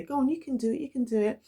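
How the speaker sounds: high, coaxing sister voice; encouraging sister. Retold or imitated. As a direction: urge him on like a big sister. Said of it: "She always just say".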